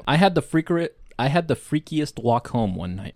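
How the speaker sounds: ordinary delivery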